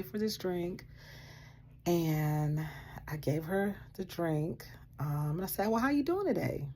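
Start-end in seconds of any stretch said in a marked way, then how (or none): 5.7-6.7 high, sing-song check-in; cheerful small talk; friendly greeting. Retold or imitated. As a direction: lilt it out politely. Second friendly greeting, ignored.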